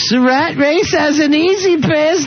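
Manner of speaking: high slow joke-reading voice. Acted. Host reads a listener's Oscar joke on air in a high, slow goofy voice. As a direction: stretch it out high and silly.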